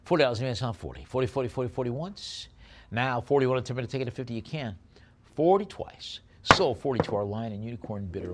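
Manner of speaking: fast, punchy auctioneer patter; auction bid calling. Acted. Auctioneer to online bidders, brisk bid calling. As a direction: rattle off the bids and knock it down.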